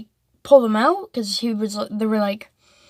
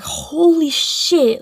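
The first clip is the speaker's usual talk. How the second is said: stunned disbelief; 5 semitones higher, much slower than usual. Retold or imitated. Kids stunned after confiscation.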